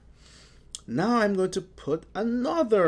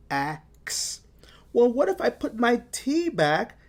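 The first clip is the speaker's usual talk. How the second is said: normal talking voice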